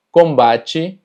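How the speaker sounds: combate ending in "chi"; TE pronunciado chi. Shown as a demonstration. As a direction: turn the final te of combate into "chi". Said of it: "T E se pronuncia como chi". (combate con final en «chi»)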